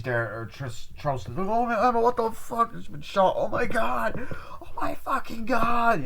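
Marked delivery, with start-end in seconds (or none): 1.4-6.0 very high, strained mock wailing; hysterical panic. Retelling friends seeing Emma shot, mock hysterical panic. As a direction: wail it high, dragging out the last cry.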